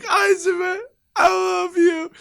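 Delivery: high, loud crying shout; a child crying out to his hockey hero; tearful wail. Retold or imitated. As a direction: sob your love out to the captain. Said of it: "just crying".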